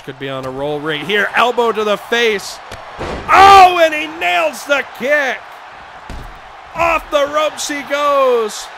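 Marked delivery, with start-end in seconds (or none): none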